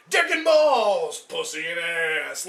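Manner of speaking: crude mock announcer. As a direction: bellow each phrase, stretched out. Mock shouting out a vulgar name.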